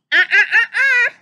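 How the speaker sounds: high squawking duck voice, loud; Donald Duck squawk. Acted. Butler Donald stops Mickey with a squawk. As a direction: squawk the stop like a cartoon duck.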